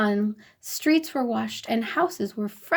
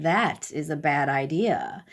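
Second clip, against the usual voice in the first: king's calm reply; 6 semitones lower than usual. A narrator's reading. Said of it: "King Wacky nodded".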